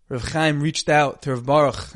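ordinary delivery

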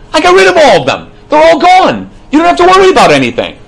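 God declaring it all done, up high and loud. Acted. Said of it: "And Hashem's like".

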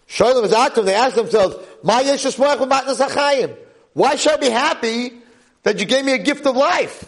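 high-pitched, impassioned declaiming; full-force preaching. Real, not acted. Rabbi voicing the depressed learners' question, preaching hard. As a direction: declaim the text and its translation fervently.

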